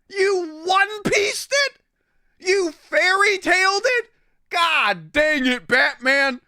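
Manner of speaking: high, loud, incredulous outburst; mock-furious shouting at Batman; outraged rant. Real, not acted.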